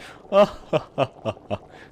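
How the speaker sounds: loud, bright giggle; her real laugh. Shown as a demonstration. Witch showing which laugh is truly hers, giggling. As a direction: show the laugh that's really yours. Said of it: "I laugh like".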